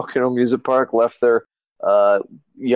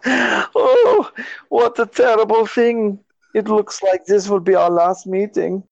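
higher, hammed-up German accent with a cry; mock German villain accent; 9 semitones higher than usual. Mock German villain gloating over the doctor in a hammed-up accent. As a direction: overplay a cartoon German villain's fake regret. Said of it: "better than your German accent".

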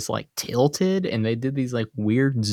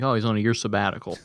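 fast mock explanation; 3 semitones lower, much faster than usual. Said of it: "He's like".

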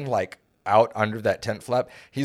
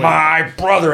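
loud, higher brother cry; mock shout; 6 semitones higher, 7 dB louder, slower than usual. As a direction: bellow it in mock rage. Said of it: "and then he kicks something".

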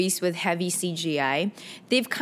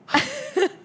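high laugh. Real, not acted. Laughing at own confession.

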